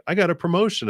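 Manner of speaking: high-pitched, acted excitement; excited announcement. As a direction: announce proud news, pitched well up. Acted homecoming announcement of good news.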